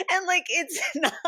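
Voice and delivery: words broken by high giggles; laughing through words. Real, not acted.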